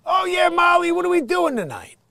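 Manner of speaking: high, loud booming sitcom-husband call; Billy Gardell's big voice. Retold or imitated. Imitating the comic's big sitcom voice to the audience. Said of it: "like, that voice".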